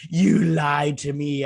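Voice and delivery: loud, drawn-out mock accusation; mock betrayal. Husband mocking a reaction to superhero secret, hammed-up accusing. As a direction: stretch it out as hammed-up outrage.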